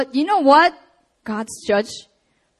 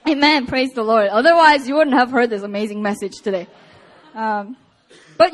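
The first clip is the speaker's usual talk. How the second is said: usual speaking voice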